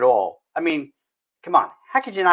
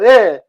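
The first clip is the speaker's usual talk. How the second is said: mock disgusted ugh; 3 semitones higher, 9 dB louder than usual. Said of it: "people don't go".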